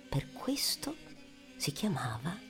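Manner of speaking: normal talking voice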